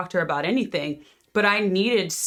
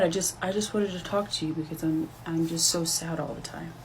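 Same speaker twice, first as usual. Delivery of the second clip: sad and small; 5 dB quieter than usual. Acted. Daughter confiding to her mother she is always sad: soft, subdued. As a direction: speak quietly, as if afraid to say it.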